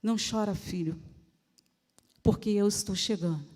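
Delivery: low, hushed reassurance; gentle voice of Jesus consoling; tender comforting. Acted. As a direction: console a child softly, letting each phrase fall away. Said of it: "Jesus todo dia chega pra gente e fala".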